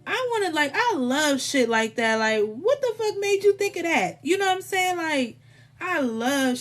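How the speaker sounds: thrilled, high-pitched gushing; excited. Real, not acted. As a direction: geek out over the card's artist. Thrilled by the deep card art.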